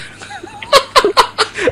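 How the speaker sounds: high-pitched burst of laughing; real laughter. Real, not acted. Host amused by grandma's youthful voice, laughing.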